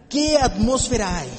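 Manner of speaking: normal talking voice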